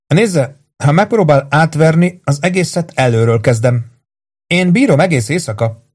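a hint of menace; taunting torturer. Voices Carmel warning the victim.

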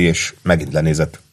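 usual speaking voice

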